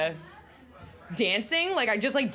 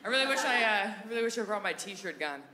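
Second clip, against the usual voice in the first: ordinary talk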